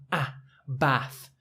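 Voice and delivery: ah and bath with a short flat a. Shown as a demonstration. Teacher models short a bath. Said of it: "that short A sound".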